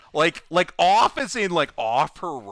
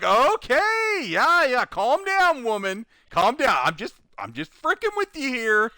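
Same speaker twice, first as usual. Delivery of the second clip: teasing; 8 semitones higher than usual. Retold or imitated. Teasing the customer.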